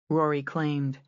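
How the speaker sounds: plain reading voice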